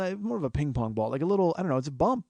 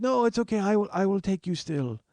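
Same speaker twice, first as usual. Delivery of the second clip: put-on higher-pitched, botched Ethiopian cabbie accent; bad Ethiopian accent; 6 semitones higher than usual. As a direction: insist politely in a poor Ethiopian accent. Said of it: "I can't do an Ethiopian accent".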